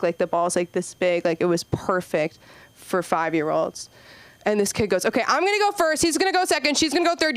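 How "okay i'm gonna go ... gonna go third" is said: put-on high, bossy little kid giving orders; a child lining up turns.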